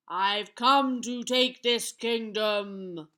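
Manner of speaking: loud, slow, booming giant; wicked giant. A narrator's reading. Giant announces he will take the kingdom, wicked and booming. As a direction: draw it out loud like a menacing giant. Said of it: "the wicked giant said".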